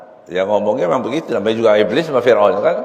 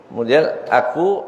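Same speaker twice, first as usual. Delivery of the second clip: usual speaking voice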